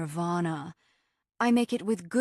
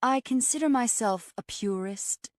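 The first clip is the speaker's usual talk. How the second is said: higher-pitched, superior declaration; Moonbliss, haughty; 5 semitones higher than usual. A narrator's reading. Raw vegan explains her diet to a meat eater, haughtily. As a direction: announce it with lofty self-regard. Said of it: "Moonbliss explained haughtily".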